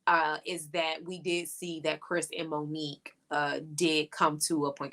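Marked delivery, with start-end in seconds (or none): none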